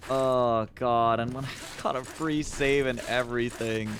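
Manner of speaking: usual speaking voice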